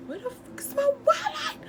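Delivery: shrill panicked shout, cracking; panicked yell. Retold or imitated. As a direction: yell in sudden panic at the register.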